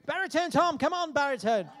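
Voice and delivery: loud laughing call. Real, not acted. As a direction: call him up across the room. Calling the guest up.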